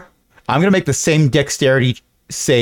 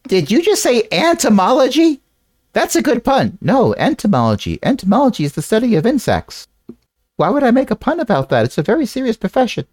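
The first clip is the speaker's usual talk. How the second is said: indignant character voice; 10 semitones higher than usual. Acted.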